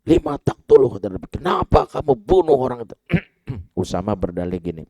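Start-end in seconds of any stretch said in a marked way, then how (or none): 0.0-1.0 loud, high angry demand; angry rebuke. Retold or imitated. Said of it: "Nabi dengar ini marah". 1.3-3.0 loud, high angry demand; angry rebuke. Retold or imitated. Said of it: "Nabi dengar ini marah".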